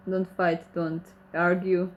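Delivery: ordinary talk, nothing marked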